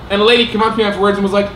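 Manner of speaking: ordinary talk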